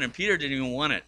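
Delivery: ordinary talk, nothing marked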